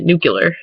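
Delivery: "nuclear" in Michigander style, ending -kyuh-ler; Michigan pronunciation. Shown as a demonstration. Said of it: "Michigander way of saying that".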